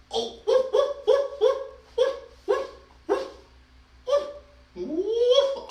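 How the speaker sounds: put-on high yappy woofs for the kids; playful dog barking. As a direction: bark like a cheerful little puppy. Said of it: "So I'm gonna bark like a dog".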